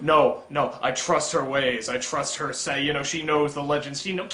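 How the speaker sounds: low, soft, subdued man's voice; dismissive character quote. Acted. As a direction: play him quietly sure of himself. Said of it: "and he's just like".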